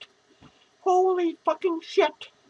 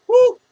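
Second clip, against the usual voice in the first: high, loud "woo"; 6 semitones higher, 10 dB louder than usual. Real, not acted. Drunk vlogger hollering to viewers, a loud whoop.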